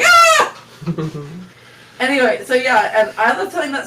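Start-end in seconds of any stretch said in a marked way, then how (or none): 0.1-1.2 loud high scream; mock scream. Retelling the shared scream at the spider, loud and high. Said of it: "so we're like".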